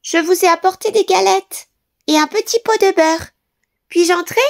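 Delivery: wolf sweetly pretending to be the girl; wolf disguised voice. A narrator's reading. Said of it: "en changeant sa voix". (voix déguisée du loup, très aiguë)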